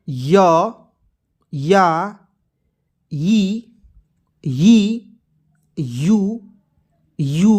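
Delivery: one drawn-out syllable at a time, long pauses between; syllable-chart recitation. Shown as a demonstration. Hindi teacher reciting the barakhadi to learners, syllable-chart recitation. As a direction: recite each letter form deliberately for learners.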